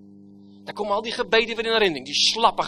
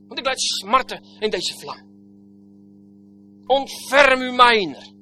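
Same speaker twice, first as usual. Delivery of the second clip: loud, higher, anguished begging; rich man begging; 6 semitones higher, 8 dB louder than usual. Acted. Rich man in the flames begging Abraham, loud anguished plea. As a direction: plead for one drop of water. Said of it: "Hij bidt".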